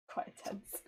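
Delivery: breathy laugh through the words. Real, not acted. Vlogger laughing about her shoe bargain. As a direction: laugh it out as you say it.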